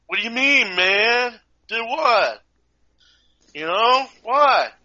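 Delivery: high, loud, lazy stoner whine; stoner kid. Retold or imitated. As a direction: play the dopey pothead kid. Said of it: "potheaded kid".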